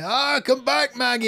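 put-on high, loud old-man wail; heartbroken old man. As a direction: cry out for a wife who left. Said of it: "I'm like an old guy".

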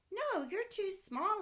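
high firm no from dad; dad refusing. Acted. Dad telling Sam he is too small, firm refusal. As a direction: turn the kid down kindly but firmly. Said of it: "his dad replied".

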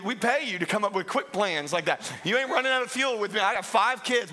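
ordinary delivery

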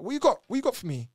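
high, loud demanding street voice; mock inmate pressing for drugs; pushy inmate. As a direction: lean on him for contraband.

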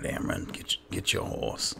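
ordinary talk

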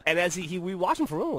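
plain reading voice

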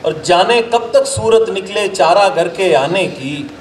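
measured, declaimed Urdu couplets with pauses; ghazal recitation. Acted. Poet presenting a ghazal to an appreciative gathering, declaimed recitation. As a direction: recite verse to a mushaira audience, line by line.

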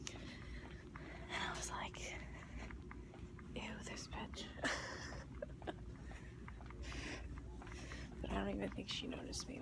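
whispered story about an ex-friend; whispering about the ex-best friend; gossipy whisper. Real, not acted. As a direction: hush it like gossip.

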